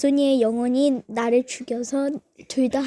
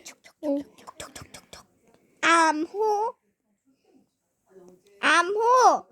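whispering a secret password; 2 semitones higher than usual. Pretend. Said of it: "암호를 대야 한다".

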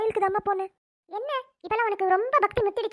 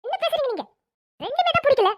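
angry retort; 5 semitones higher, 3 dB louder than usual. Acted. Mother snaps back at grandmother.

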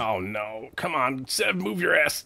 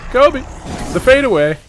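loud, high excited shout; hype call; 10 semitones higher, 6 dB louder than usual. Real, not acted. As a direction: yell it like a highlight play. Calling out his game play.

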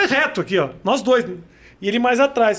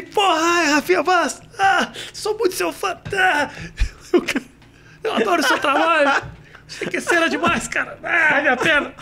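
high, moaning starstruck voice; injured gushing fan; 7 semitones higher than usual. Retold or imitated. Hurt driver recognizes the famous comedian, high pained gushing. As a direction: imitate fan praise broken by groans of pain.

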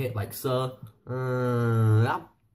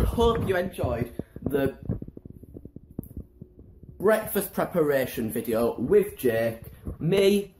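youtuber outro; 4 semitones higher, 5 dB louder, slower than usual. Pretend. Mock video outro.

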